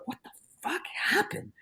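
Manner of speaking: whispered, baffled question; retold shock, barely voiced; stunned whisper. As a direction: mouth it in hushed disbelief. His stunned inner reaction, retold.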